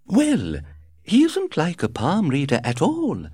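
high, chirpy, breezy society lady; gushing society hostess. A narrator's reading. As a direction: flutter through it like a gossiping hostess. Lady Windermere speaks.

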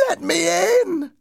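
piercingly high ghostly cry. A narrator's reading. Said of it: "a voice cried".

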